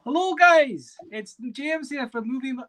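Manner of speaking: high, loud mock Scottish accent; a hammed-up Scottish accent greeting the stream; bad Scottish accent. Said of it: "that was my worst Scottish accent".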